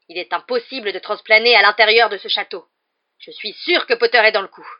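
loud, high-pitched furious accusation; enraged roar. A narrator's reading. Rogue accusing Potter of helping an escape, roaring. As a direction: roar it with total conviction. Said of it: "rugit Rogue qui semblait tout proche".

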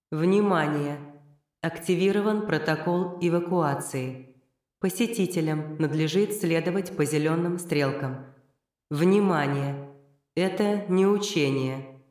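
slow formal PA announcement; evacuation loudspeaker. Acted. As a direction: announce it over the speakers. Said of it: "Голос из громкоговорителей".

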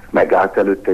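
ordinary delivery, nothing marked

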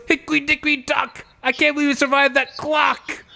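high, hammed-up rhyming punchline; Andrew Dice Clay impression. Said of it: "And he goes".

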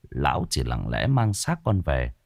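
ordinary delivery

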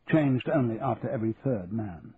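plain reading voice

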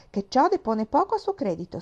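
Mary presses her point; fervent. A narrator's reading. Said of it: "esclamò Mary con fervore". (con fervore)

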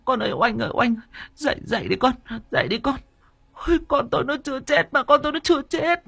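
very high, frantic wailing; a mother refusing to believe her child is dead; hysterical sobbing. Acted. Mother wailing over her daughter's body, frantic high cries. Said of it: "ôm lấy xác con gái mà khóc ngất".

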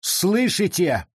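high, drawn-out call. A narrator's reading. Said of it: "Возвысив голос".

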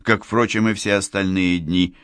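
plain reading voice

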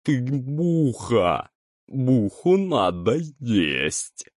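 very slow, hungry monster chorus; gurgling alien voice. A narrator's reading. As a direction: drawl it like a hungry swamp beast. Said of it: "в один голос произнесли твари".

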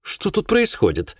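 high, startled woman's question; anxious, high-voiced demand; alarmed. A narrator's reading. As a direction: ask in sudden alarm, pitched high. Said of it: "обернувшись в тревоге, уставилась на меня".

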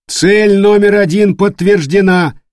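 high, loud, slow report; loud announcement. Acted. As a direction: announce it loud across the bridge. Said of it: "объявил второй вахтенный".

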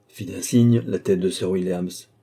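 ordinary delivery, nothing marked

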